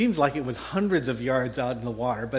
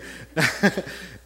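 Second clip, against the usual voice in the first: breathy laugh. Real, not acted. Laughing at paddling plan.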